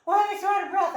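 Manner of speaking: high, loud mock complaint; exaggerated out-of-breath whine, high and loud; mock exercise complaint. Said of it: "if you're like".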